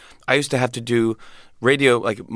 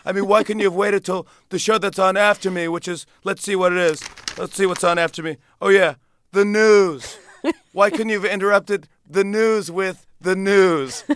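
Trump impression; 8 semitones higher than usual. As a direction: drag out the punchline as Trump. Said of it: "I did a Donald Trump impression".

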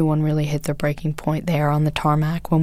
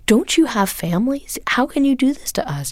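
tearful plea; 8 semitones higher than usual. Retold or imitated. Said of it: "And I started crying".

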